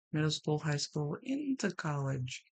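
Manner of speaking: usual speaking voice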